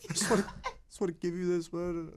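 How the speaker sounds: mock crying, high and choked up; tearful giver. Crying kid hands over a gift, tearful. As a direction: imitate a kid crying as he offers it. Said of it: "he's crying so hard".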